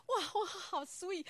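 high, slow, melting wow; delighted gush. Real, not acted. Mother touched by her son's request for a kiss, gushing.

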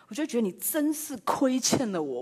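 ordinary talk

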